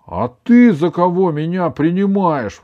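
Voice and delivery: indignant. A narrator's reading. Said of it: "возмутился Иван".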